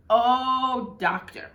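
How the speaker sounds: hammed-up delighted broadcaster sign-off; imitating the radio man's trademark exclamation; Red's catchphrase. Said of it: "end his calls with".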